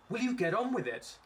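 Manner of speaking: high, loud, exasperated demand; exasperated boss losing patience; impatient. Acted. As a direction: hurry him along, voice shooting up. Boss snaps at rambling employee.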